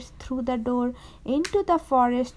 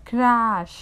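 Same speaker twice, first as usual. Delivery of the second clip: loud, drawn-out storybook crash; sudden louder burst; dramatic crash; 2 semitones lower, 4 dB louder than usual. A narrator's reading. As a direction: hit the word hard like the chair breaking. Narrator voices the chair breaking.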